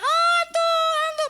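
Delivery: high panicked cry of ghost; frightened shouting. Retold or imitated. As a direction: shriek it like terrified disciples. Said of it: "Ketika para muridnya teriak-teriak". (teriakan ketakutan yang tinggi)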